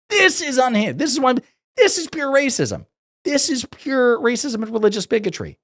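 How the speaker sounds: very high-pitched, slowed disbelief; high-pitched exasperated protest, hitting each phrase; incredulous outrage. Real, not acted. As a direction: cry out in exasperation. Outraged reaction to rant.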